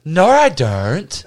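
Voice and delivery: slow Australian No, I don't, with an R in don't; friend's Australian denial, slowed down. Retold or imitated. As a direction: imitate Stevie denying it, slow and drawled. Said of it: "And he says".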